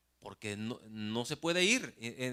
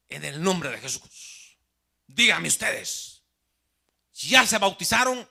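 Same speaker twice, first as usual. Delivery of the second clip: loud, slow, higher demanding questions; mock zealot interrogating visitors, louder and higher; aggressive challenge; 6 semitones higher, 11 dB louder, slower than usual. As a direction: grill the strangers forcefully. Zealots confront the seated visitors.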